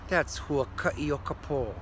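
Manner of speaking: plain reading voice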